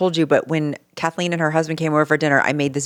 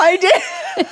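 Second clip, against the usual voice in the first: laughing. Real, not acted. Laughing about the strawberries.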